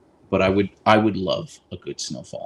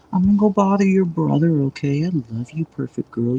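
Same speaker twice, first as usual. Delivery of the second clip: higher doting voice for the dog; talking to her dog in a higher voice; pet talk; 7 semitones higher, 5 dB louder than usual. Real, not acted. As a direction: coo to her dog, pitched up.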